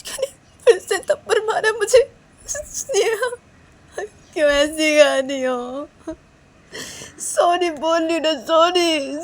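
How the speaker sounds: high wail settling into drawn-out begging; tearful pleading. Acted. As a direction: plead after being slapped, almost crying. Slapped Neha wails and begs forgiveness. (रुआँसी आवाज़ में मिन्नत)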